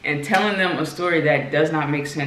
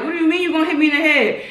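mock disbelief; 9 semitones higher, faster than usual. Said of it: "And I'm like".

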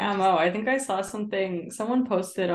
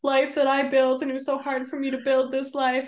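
mock tearful Scheana; 6 semitones higher than usual. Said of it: "she's like".

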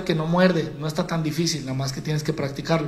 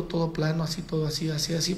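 a flat monotone dropped much softer; flat and quiet; 8 dB quieter than usual. Shown as a demonstration. Coach showing a boring speaker: flat, much softer voice. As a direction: mumble it flat with no life. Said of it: "No platiques así todo bajito".

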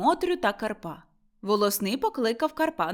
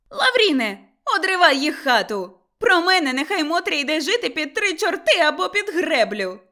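high, loud furious yell; screaming at her son; angry shouting; 7 semitones higher, 6 dB louder than usual. A narrator's reading. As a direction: call Lavrin at full voice. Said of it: "кричала Кайдашиха".

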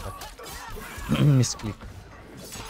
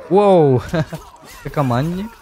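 surprised; 5 semitones higher, 12 dB louder, slower than usual. Real, not acted. Surprise at opponent's move.

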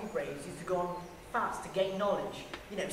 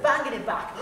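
ordinary talk, nothing marked

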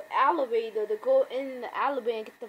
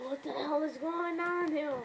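higher, lightly voiced Rick; Rick's catchphrase; 4 semitones higher than usual. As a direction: imitate his favourite line. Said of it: "He likes saying that freaking word".

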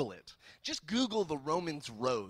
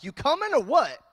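loud, higher attempt at Canadian accent; bad Canadian accent; 7 semitones higher, 11 dB louder than usual. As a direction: blurt it in a garbled Canadian accent. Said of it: "So that was my Canadian accent".

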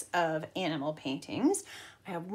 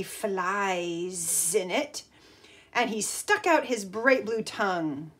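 put-on lively storybook read-aloud for kids; reading to children; 6 dB louder, much slower than usual. A narrator's reading. Teacher reading a picture book to the class, lively storytime voice.

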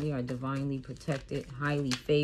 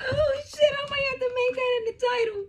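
very high, loud, laughing words; real high laughing squeal; laughing hard; 4 dB louder than usual. Real, not acted.

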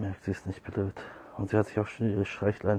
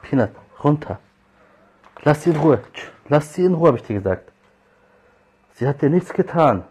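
raised voice, high and loud commands; angry scolding; 6 semitones higher, 10 dB louder than usual. Real, not acted. Owner defending the cat from Peanut, angry scolding. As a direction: sharply order a pet down, really cross.

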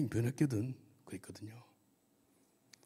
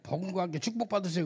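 higher, teasing blessing greeting; mock sweet blessing; 8 semitones higher, 4 dB louder than usual. Shown as a demonstration. Preacher contrasts the popular blessing with suffering: higher, teasing. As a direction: lift it up like the easy version everyone wants. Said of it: "그럼 아멘 나올 건데".

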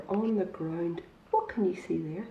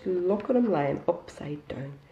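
ordinary delivery, nothing marked

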